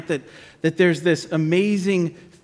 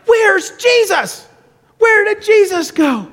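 high, drawn-out frantic calling; hammed-up panic, calling out for help; acted terror; 14 semitones higher, 4 dB louder, slower than usual. Terrified disciples searching the boat, acted panic. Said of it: "They were terrified".

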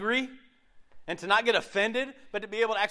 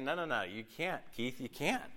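soft, lowered, patient refusal; gentle correction; 5 semitones lower, 9 dB quieter than usual. Acted. Jesus telling the preacher he can't be offended, gentle. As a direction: correct him quietly, softer and lower.

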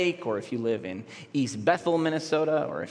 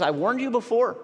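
quoting Paul; 9 semitones higher, 3 dB louder than usual. Retold or imitated. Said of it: "that Paul says".

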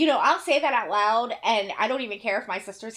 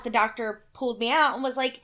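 normal talking voice

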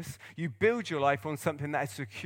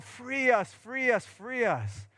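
higher, pleading cry to God that fades on the last; 6 semitones higher, slower than usual. Retold or imitated. Israelites in slavery crying out to God, higher pleading cry. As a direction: call out like slaves begging for rescue. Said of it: "they're crying out to God".

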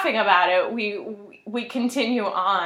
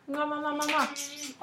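air kisses; 4 semitones higher, 9 dB quieter than usual. Real, not acted.

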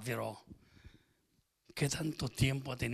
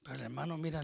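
ordinary talk, nothing marked

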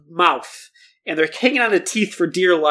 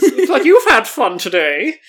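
slow, higher and louder, laughing through it; jolly gloating host; 6 semitones higher, 5 dB louder, slower than usual. A narrator's reading. Jolly host greeting the kids, slow smug laugh. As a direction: ham up the smug jolly uncle.

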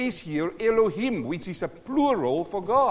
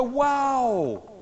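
high, drawn-out "Wow" held short of a shout; moderately voiced, high-pitched amazement; toned-down wow; 5 semitones higher than usual. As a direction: redo the amazement with the volume reined in. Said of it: "I did that too loud".

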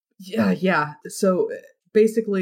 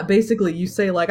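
ordinary talk

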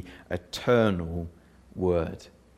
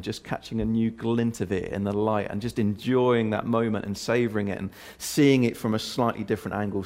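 normal talking voice

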